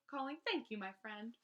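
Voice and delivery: ordinary delivery, nothing marked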